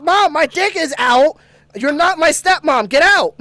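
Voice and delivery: high, loud, hammed-up shout of alarm; frantic yell. Kid as mom opens the door, retold frantic yell. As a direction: shout her out in mock panic.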